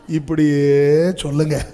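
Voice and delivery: ordinary delivery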